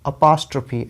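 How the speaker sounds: अपॉस्ट्रॉफी with a short "uh" and stressed POS; correct pronunciation. Shown as a demonstration. Teacher models the word for students, correct अपॉस्ट्रॉफी stressed on POS. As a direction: stress the second syllable of अपॉस्ट्रॉफी, uh-POS-truh-fee. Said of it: "तो कैसे आप pronounce करेंगे".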